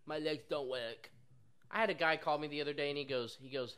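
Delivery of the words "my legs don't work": mocking put-on whiny voice, dragging at the end; lazy whiner. Pizza worker mimicking customers who won't drive, mock whining. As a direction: make fun of a lazy complainer. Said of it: "fat, lazy fucks that sit at home and go".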